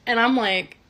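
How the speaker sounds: normal talking voice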